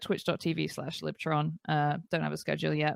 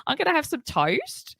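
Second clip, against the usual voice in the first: put-on "toast" drawled in a broad Australian accent; imitating an Australian soap character; 9 semitones higher than usual. Retelling a soap opera line in a mock Australian accent. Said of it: "she would say".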